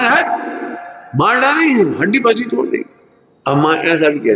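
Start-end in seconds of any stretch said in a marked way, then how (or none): 0.0-2.9 mother yelling. Retold or imitated. Mother threatening her naughty child, high mock-angry yell. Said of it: "चिल्ला के भी बोलती है".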